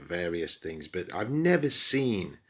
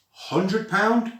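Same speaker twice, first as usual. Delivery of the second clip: high, disbelieving question; incredulous; 12 semitones higher, 3 dB louder than usual. Real, not acted. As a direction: squeak it out in pure disbelief. Outraged at the price.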